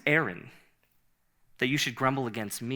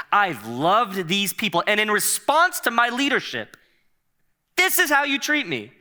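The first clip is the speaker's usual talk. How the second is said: hurt and indignant; 6 semitones higher, 4 dB louder than usual. Acted. Moses' hurt climax.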